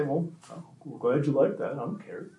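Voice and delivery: soft, sullen imitation of a kid; dismissive kid. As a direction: brush the praise off under your breath. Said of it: "A really low self-efficacy kid".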